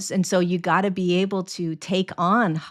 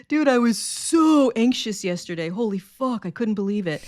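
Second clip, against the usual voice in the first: put-on higher, breezy voice of a carefree battered kid; bruised kid voice; 4 semitones higher than usual. Kid voice telling friends about yesterday's anxiety, higher and carefree. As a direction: toss it off like a kid who loves the chaos. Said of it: "the bruised kid running around like".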